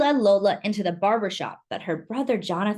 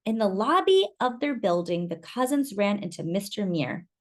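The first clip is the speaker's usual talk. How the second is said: ordinary delivery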